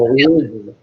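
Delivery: LOUISVILLE mashed into a loud "OO-vuh"; mouth-stuffed pronunciation. Shown as a demonstration. Showing friends how to say Louisville, loud and mouth-stuffed. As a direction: say Louisville with the consonants swallowed. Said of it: "I would go".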